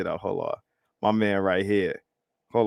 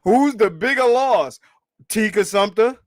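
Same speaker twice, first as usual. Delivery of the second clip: higher, louder challenge; loud taunt; 10 semitones higher, 6 dB louder than usual. Real, not acted. Streamer challenging viewers, loud and taunting. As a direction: throw the question at them.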